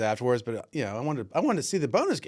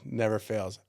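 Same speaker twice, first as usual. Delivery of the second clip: ordinary talk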